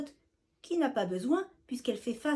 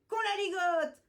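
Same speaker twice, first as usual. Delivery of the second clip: gleeful call; 8 semitones higher, 3 dB louder than usual. Acted. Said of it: "lance l'un d'eux".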